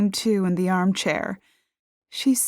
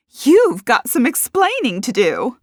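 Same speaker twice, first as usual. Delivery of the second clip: raised, high confrontation; accusing demand; 7 semitones higher, 6 dB louder than usual. A narrator's reading. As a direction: confront the suspect loudly.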